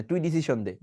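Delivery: ডিসিশন as "disi-shon", with sh; light d and voiceless sh in ডিসিশন; wrong SH pronunciation. Shown as a demonstration. Teacher quoting a wrong usage, sh in ডিসিশন. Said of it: "ডিসিশন দে বলা যাবে না".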